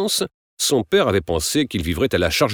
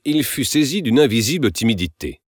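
ordinary delivery

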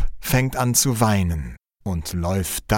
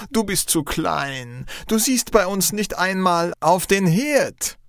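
high-pitched incredulous head cook; calling out; 9 semitones higher than usual. A narrator's reading. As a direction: exclaim in high, scoffing disbelief. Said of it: "Dann ruft er". (ungläubig und hoch ausgerufen)

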